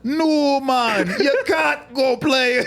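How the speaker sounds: put-on slow, high laughing Jamaican accent; hammed-up Jamaican boss. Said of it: "with a Jamaican accent".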